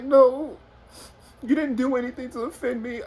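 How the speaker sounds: high mock-tearful voice; imitating crying girlfriend. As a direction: pitch it up like she's crying. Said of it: "she starts crying".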